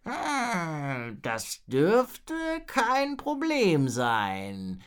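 very slow, drawn-out creaky groan; laboured, stretched-out creature voice; groaning dragon. A narrator's reading. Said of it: "ächzte der".

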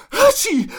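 big, high-pitched mock sneeze; loud stagey achoo mid-sentence; acted sneeze. (lautes, hohes gespieltes Niesen)

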